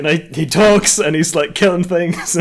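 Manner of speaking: hammed-up alarmed protest; mock insistence. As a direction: insist like nobody believes you. Voicing a man insisting, disbelieved.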